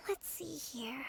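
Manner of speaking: whispering to herself as she looks; hushed, breathy aside; whispered. Acted. Searching the stolen goods, she whispers to herself.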